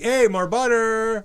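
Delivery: high, slow fake Italian accent; hammy fake Italian accent asking for butter. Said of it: "You started talking in a fake Italian accent".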